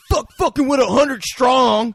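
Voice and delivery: loud, high, slow Southern holler; Southern accent. Acted. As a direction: exaggerate a Southern drawl at full volume. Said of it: "the one, like, Southern dude's like".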